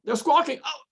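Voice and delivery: startled wife. Retold or imitated.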